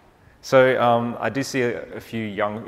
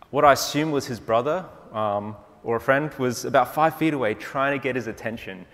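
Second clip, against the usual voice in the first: ordinary talk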